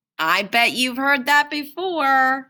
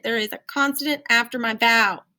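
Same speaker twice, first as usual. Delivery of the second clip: ordinary talk, nothing marked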